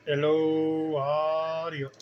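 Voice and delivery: mock holler into the mic. Shown as a demonstration. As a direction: call out the test words. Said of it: "let me really holler into it".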